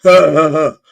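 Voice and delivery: loud, forced scoffing laugh; hammed-up "ha, ha, ha"; mock Trump laugh. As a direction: laugh it off defiantly. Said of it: "And Trump was like".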